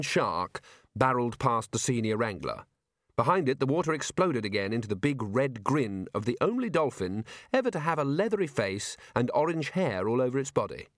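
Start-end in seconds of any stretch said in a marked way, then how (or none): none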